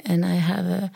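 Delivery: ordinary delivery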